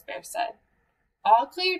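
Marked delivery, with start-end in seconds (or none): 1.2-1.8 high, louder all-clear; declaring. A narrator's reading. Mouse reporting after checking behind the curtains, declaring. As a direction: announce it briskly and confidently. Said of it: "declared Mouse".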